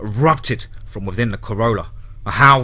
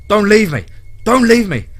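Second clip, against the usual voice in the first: much higher, louder pleading scream; desperate scream; 10 semitones higher, 8 dB louder than usual. Acted. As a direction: scream it like you're being abandoned. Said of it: "Brady screamed".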